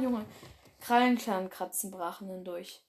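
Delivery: usual speaking voice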